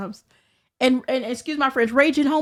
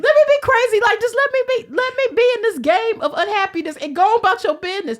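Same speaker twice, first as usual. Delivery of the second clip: loud rant; 7 semitones higher, 5 dB louder than usual. Real, not acted. Ranting about game rival.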